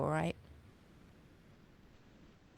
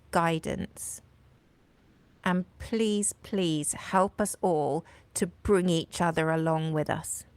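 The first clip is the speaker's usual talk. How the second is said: prayer; 4 dB louder than usual. Acted. Praying to God for strength in the fight, hushed and sincere.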